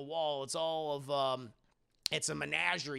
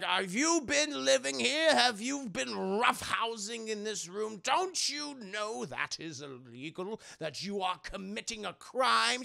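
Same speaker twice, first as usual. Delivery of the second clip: slow, higher, mock-stern lecture; stern scolding; 5 semitones higher, 4 dB louder than usual. Henry lecturing the girl about trespassing, mock-stern. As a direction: scold her like a cranky landlord. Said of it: "he's like".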